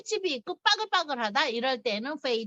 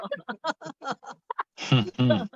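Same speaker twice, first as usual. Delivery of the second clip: low, drawn-out chuckle; laughing; 7 semitones lower than usual. Real, not acted. Amused by the playboy explanation, laughing. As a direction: let a long laugh out.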